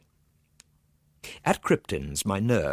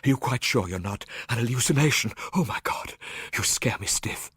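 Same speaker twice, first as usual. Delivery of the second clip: frightened; 3 semitones higher than usual. A narrator's reading. Frightened doctor speaks.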